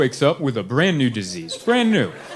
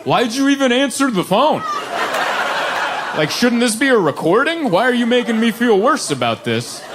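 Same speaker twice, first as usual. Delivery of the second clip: higher-pitched exasperated complaint; mock indignation; 8 semitones higher than usual. Caller to insurance lady who answered, indignant. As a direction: protest like a wounded, baffled caller.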